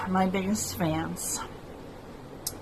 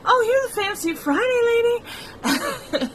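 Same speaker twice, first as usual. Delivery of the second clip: much higher, tearful take on her father; imitating her dad; 13 semitones higher than usual. Daughter quoting her dad recognizing her, tearful mimicry. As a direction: echo him brightly while tears slip in.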